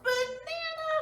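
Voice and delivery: goofy high banana; silly banana. Acted. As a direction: squeak it out like a giggle. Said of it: "The reader said".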